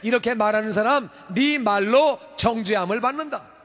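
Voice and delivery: fiery sermon delivery; full-force preaching. Acted. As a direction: proclaim it from the pulpit.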